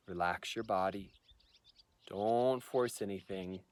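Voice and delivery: soft, unhurried meditation voice with long pauses; guided meditation. Shown as a demonstration. Teacher guiding viewers through the microcosmic orbit practice, calm meditation voice. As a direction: ease them gently into stillness, leaving space between phrases.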